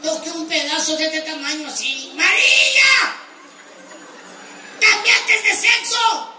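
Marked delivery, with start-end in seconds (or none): 2.1-6.3 high, loud, slow outcry; shocked yell. Retold or imitated. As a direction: yell it in stunned horror. Shocked discovery punchline.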